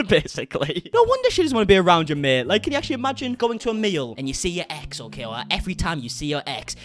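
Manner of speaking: ordinary talk, nothing marked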